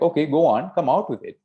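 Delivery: impatient prodding. Acted. King prodding his silent youngest daughter, impatient. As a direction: coax it out of her impatiently.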